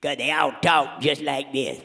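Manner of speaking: lazy Louisiana drawl. Acted. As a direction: slip into the Louisiana bluesman voice. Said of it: "the voice of anybody from Louisiana".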